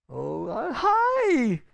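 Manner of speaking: bright high greeting to the pain; cheery hello to an old friend; mock greeting. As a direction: welcome it like a guest. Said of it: "you throw it a party".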